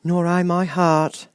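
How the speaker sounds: slow, high tin man voice; lightly voiced sad tin man; Tin Woodman voice. A narrator's reading.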